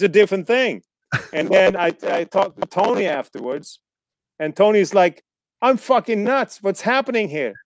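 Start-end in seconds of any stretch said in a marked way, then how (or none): 5.6-7.5 higher-pitched, overwhelmed co-star, retold; mock bewilderment. Co-star after filming on green screen, mock bewilderment. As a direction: act out someone losing track of it all. Retells bewildered co-star's rant.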